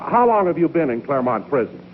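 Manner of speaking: ordinary delivery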